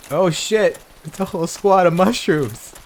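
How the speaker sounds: loud, high alarmed yell at the ambush; startled shout. Real, not acted. Player yelling as a mushroom squad charges. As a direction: shout it as they charge you.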